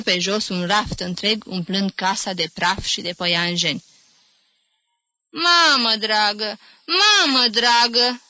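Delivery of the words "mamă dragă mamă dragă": slow, wailing lament. A narrator's reading. Old aunt bewailing her scattered books, slow lament. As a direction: wring the words out slowly. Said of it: "se căina cu mâna la inimă".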